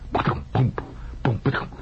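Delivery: muffled imitated thumps; faint thumpings. Storyteller mimics noises behind the dentist's door, faint thumping. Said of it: "faint thumpings".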